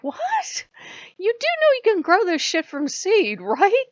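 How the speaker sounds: baffled and outraged at once; incredulous shriek. Retold or imitated. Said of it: "I'm like".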